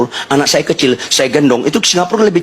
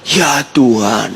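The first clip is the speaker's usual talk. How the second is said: ordinary talk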